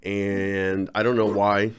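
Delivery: normal talking voice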